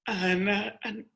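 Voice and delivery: ordinary talk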